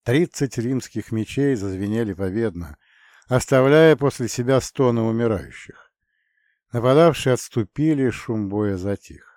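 usual speaking voice

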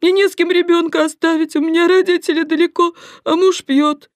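high, loud tearful plea; wailing. Acted. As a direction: beg through tears, voice up high. Blonde crying to the doctor.

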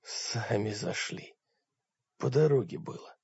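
half-conscious croak from the floor; hoarse, eyes closed. Acted. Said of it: "тот прохрипел с закрытыми глазами".

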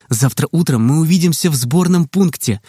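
ordinary delivery

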